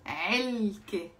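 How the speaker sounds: "علكة" slow and stretched, throat ayn at the start; strong ayn. Shown as a demonstration. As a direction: repeat "علكة" slowly as a model, 3el-ke. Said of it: "It's not إلكة".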